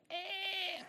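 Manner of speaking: put-on baby whimper. Imitating a baby starting to squall.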